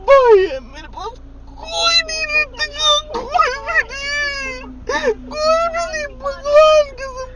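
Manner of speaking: high, loud crying wail; wailing that he has no one; wailing sobs. Real, not acted. Breaking down, nobody left.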